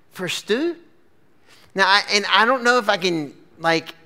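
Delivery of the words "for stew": high, disbelieving question; incredulous. Acted. Brother asked to trade birthright for stew, incredulous. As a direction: question the absurd trade in mock bafflement. Acted reaction to insane request.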